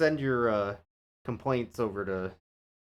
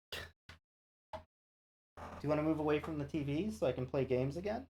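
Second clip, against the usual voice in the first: normal talking voice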